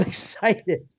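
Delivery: ordinary delivery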